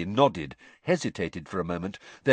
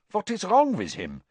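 alarmed; 9 semitones higher than usual. Acted. Krum alarmed.

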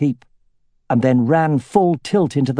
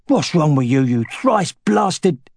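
soldier's oath; 3 semitones higher, slower than usual. A narrator's reading. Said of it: "uttered an oath as he struggled".

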